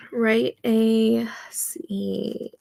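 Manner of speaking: ordinary delivery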